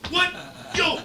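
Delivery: high, loud mock outrage; losing it. Imitating Mister Watts raging at a prank call, losing it. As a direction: imitate an incredulous man blowing up. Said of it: "Mister Watts is losing it".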